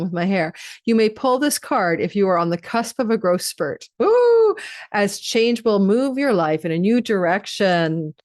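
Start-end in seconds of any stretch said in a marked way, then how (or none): 4.0-4.6 high, loud excited ooh; delighted ooh. Real, not acted. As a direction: squeal with delight. Reacting to growth spurt card.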